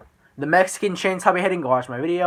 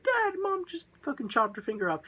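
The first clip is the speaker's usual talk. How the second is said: put-on high, thin child voice, a touch softer; panicked little girl; 10 semitones higher, 4 dB quieter than usual. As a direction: play the scared daughter telling Dad. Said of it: "something along the lines of".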